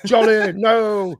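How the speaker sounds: slow, stiff mock British accent as Michael Caine. Host mocking the actor's British accent in the Muppets film, slow and hammed. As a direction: drag out a deadpan British accent, hamming it up. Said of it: "I can do a better British accent than that".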